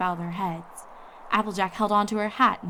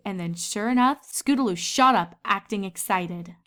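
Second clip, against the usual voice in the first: ordinary delivery, nothing marked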